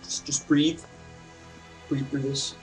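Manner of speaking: normal talking voice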